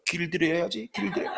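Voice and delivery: husband's quiet self-congratulation; smug mutter to self. Retold or imitated. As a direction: murmur it while drinking. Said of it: "먹으면서".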